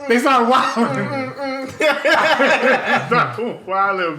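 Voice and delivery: high, slow through laughter; cracking up while saying it; laughing. Real, not acted.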